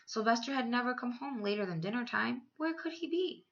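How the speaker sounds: ordinary delivery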